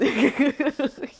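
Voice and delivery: high, loud burst of laughter. Real, not acted. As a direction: let out a big cackle. Big laugh after story.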